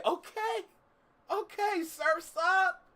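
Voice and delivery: very high put-on cheer; playful high squeal. Streamer riffing on a beach day, playful squeaky shout. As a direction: squeak it out in mock excitement.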